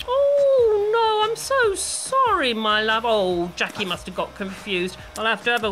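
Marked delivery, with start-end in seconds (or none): 0.1-3.0 high, slow, hammed-up sorry; sugary fake remorse, drawn out; overdone apology. Mock apology.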